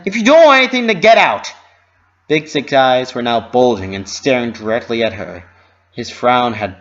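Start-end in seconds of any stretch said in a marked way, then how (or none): 0.0-1.5 loud, high yell to get out; angry shout. A narrator's reading. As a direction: bark at a pest to leave. Vendor yells.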